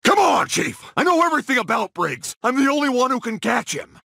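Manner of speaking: high, urgent appeal; impassioned pleading. Acted. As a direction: beg the chief, pitch pushed up. Begs chief for the case.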